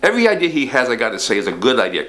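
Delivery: ordinary talk, nothing marked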